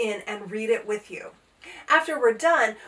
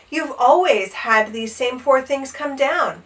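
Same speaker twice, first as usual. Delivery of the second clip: plain reading voice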